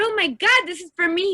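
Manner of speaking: high, fast, overjoyed; delighted gasp. Retold or imitated. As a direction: pitch jumps way up, quick and thrilled. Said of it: "And I said".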